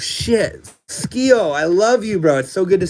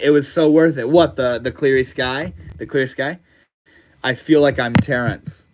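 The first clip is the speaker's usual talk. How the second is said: ordinary talk, nothing marked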